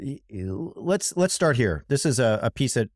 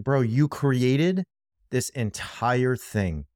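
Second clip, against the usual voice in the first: slow exasperated emphasis; much slower than usual. Real, not acted. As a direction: drag out each word, slow and pointed. Exasperated aside to McConnell.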